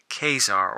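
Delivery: Kazar said as KAY-zar; long "ay" in the first syllable of Kazar; first pronunciation variant. Shown as a demonstration. Collector offering possible ways to say the name, Kazar as KAY-zar. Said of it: "whatever way you pronounce it".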